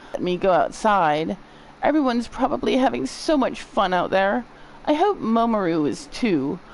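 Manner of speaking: lightly voiced patient, wistful and put-upon; a touch of weary complaint; reluctant patient character. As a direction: lightly act a patient dreading the medicine. Voicing a reluctant young patient.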